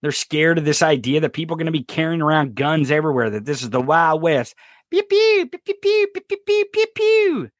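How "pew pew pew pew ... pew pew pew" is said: high, squeaky pew-pew gun sounds; mock gunfire noises. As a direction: play at shooting like a kid. Imitating gunshots, Wild West.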